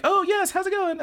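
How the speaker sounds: high, flustered greeting; flustered girl voice. Retold or imitated. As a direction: chirp it too eagerly.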